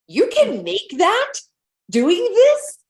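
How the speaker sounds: high, slow, stunned question; amazed disbelief. Retold or imitated. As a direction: sound floored by the number. Retelling shock at big paycheck.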